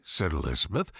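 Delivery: usual speaking voice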